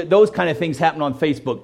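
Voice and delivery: usual speaking voice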